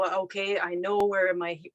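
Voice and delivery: ordinary delivery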